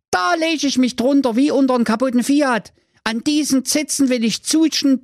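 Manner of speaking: put-on high, thrilled boyish voice; delighted cry. Brudi delighted by the cocoa cow, high excited voice. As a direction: gush with delight over the cow. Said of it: "rief voller Entzücken".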